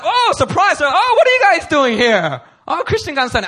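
high, loud mock surprise; surprised greeting. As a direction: greet friends with exaggerated surprise. Friends acting out a surprise greeting.

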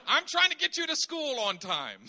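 sarcastic and exasperated; sarcastic frustration. Retold or imitated. Father to his crying daughter, sarcastic and fed up. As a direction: sigh it out as the bad guy. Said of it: "I said".

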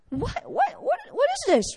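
high pitched incredulous What what what; baffled outrage. Acted. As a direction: squeal in disbelief at being snubbed. Naaman baffled by servant's note.

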